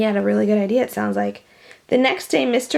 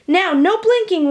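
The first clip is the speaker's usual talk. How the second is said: warning; 8 semitones higher, 4 dB louder than usual. A narrator's reading. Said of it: "warned Mister Elliot".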